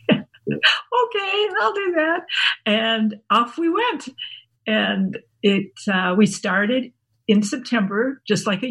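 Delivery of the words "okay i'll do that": very high, uncertain retold okay; squeaky, comic giving-in; mock hesitant agreement. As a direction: act out agreeing while daunted. Acting out her daunted agreement.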